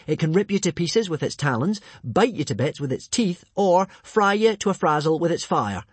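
high, punchy, relishing list; dramatic listing. A narrator's reading. Hiccup impressing the monster, high dramatic telling. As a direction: build each threat to a climax. Boy lists rival's deadly powers.

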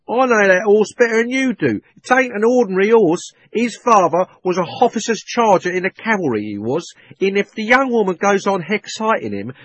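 loud, high urgent warning; cockney cabby. A narrator's reading. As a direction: insist you know the horse. Cabby urging.

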